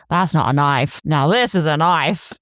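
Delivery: put-on broad Australian accent, slowing on the second half; Crocodile Dundee. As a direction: put on an Aussie movie-hero accent. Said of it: "the Crocodile Dundee scene where he's like".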